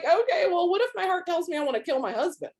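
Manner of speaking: high-pitched mock-innocent question; sarcastic retort. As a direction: toss back the absurd what-if in a high voice.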